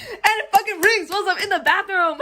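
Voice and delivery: high, laughing exclamation; loud-ish incredulous storytelling, laugh breaking in; exasperated outburst. Real, not acted. Exasperated climax of doorbell story.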